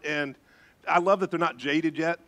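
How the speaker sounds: ordinary talk, nothing marked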